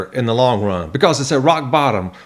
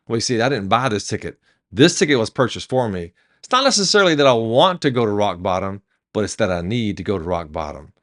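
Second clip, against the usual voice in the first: lower, measured explanation; subdued, low-key acted passenger; calm reply; 5 semitones lower than usual. Second passenger calmly answers the excited one.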